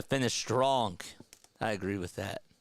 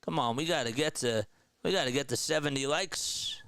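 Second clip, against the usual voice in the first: normal talking voice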